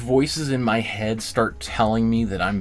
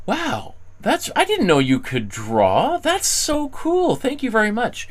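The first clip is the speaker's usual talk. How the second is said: impressed; 9 semitones higher than usual. Retold or imitated. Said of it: "they're like".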